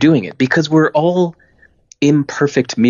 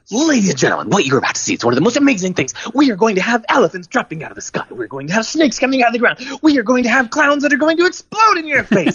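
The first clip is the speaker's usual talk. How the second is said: hammed-up barker, much higher, building to a shout; over-the-top carnival barker; 14 semitones higher than usual. Ringleader finally hamming it up to the circus crowd, over-the-top barker. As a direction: sell it like a ridiculous carnival barker. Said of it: "acted like a carnival barker".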